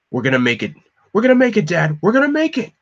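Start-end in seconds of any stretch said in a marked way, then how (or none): 1.1-2.7 much higher, louder, panicked yell; desperate shout. Acted. Tim shouting to his dad over the comm, desperate yell. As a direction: shout it to keep hope alive.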